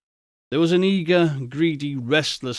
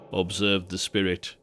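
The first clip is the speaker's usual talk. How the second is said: ordinary delivery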